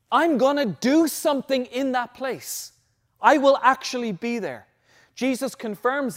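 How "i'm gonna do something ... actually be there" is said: loud, higher, deliberate preaching; emphatic divine promise. Acted. Preacher voicing God's promise to his people, emphatic. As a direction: lightly voice God, pressing every word home.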